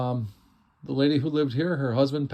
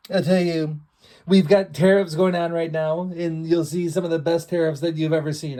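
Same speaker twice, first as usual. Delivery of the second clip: loud, higher put-on politician voice; bad impression; 4 semitones higher, 5 dB louder than usual. Estate sale host mocking tariffs in a put-on voice. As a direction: ham up a showy politician impression. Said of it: "It's not a very good impression".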